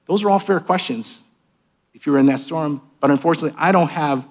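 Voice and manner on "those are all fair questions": normal talking voice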